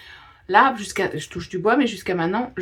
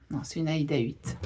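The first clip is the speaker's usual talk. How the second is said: ordinary talk, nothing marked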